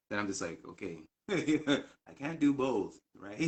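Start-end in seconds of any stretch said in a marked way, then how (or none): 0.7-3.0 higher, louder, drawn out through a laugh; laughing reply. Real, not acted. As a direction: laugh while protesting, slowly. Laughs retelling own reply.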